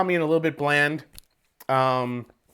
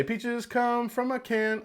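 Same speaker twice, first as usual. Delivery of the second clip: slow, high, softer mock quote; 8 semitones higher, 6 dB quieter, slower than usual. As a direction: quote it slowly and mockingly. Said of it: "Oh, no, they did say".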